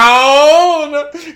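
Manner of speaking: loud, high drawn-out brown; shocked reveal. Real, not acted. Telling friend about the brown tongue, loud disbelief. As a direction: stretch the word in disbelief.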